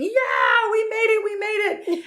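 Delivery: high, loud cheer of relief; a squealing victory whoop; celebrating. Retold or imitated. Finally home after the night drive, cheering. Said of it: "we start to celebrate".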